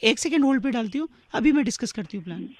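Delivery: quiet. Real, not acted. Operator speaks softly on the call.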